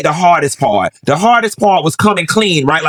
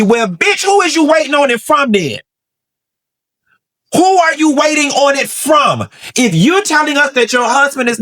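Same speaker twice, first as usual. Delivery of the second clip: furious shout, much higher; angry shout; 8 semitones higher than usual. Real, not acted. Vlogger yelling a rebuttal at the wife. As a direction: demand an answer, pitch climbing.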